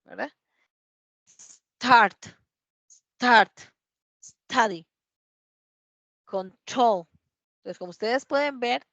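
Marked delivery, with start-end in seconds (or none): 1.3-7.1 "Start", "study", "control" with the t released in a puff; loud, separated model words "Start", "study", "control", t blown; T with air. Shown as a demonstration. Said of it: "tiramos aire".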